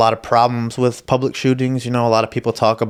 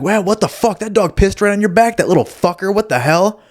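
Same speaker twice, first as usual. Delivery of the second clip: much higher, hammed-up mock indignation; joining the lady's outrage to hide that it's his dog, much higher; fake outrage; 11 semitones higher than usual. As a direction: pretend to be as outraged as she is. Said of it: "I joined in with her".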